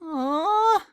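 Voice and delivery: a crushed little moan; squeaky let-down aw. A narrator's reading. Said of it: "moaned Pinkie".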